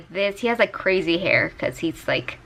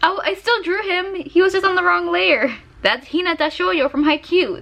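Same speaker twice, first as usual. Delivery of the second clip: excited; 10 semitones higher than usual. Real, not acted. Relief after finding drawing.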